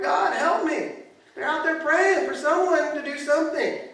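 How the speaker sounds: loud, high-pitched sermon climax; urgent shouted pleading to a congregation; impassioned preaching. Acted. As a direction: cry out the prayers at full force. Preaches at full force.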